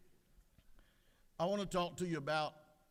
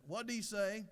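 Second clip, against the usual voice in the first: higher-pitched, puzzled query; hard-of-hearing wife; 7 semitones higher, slower than usual. Acted. Hard-of-hearing wife asks her husband, puzzled. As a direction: ask it like you missed every word. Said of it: "extremely hard of hearing".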